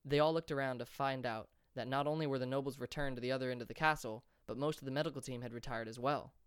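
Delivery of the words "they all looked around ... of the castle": ordinary delivery, nothing marked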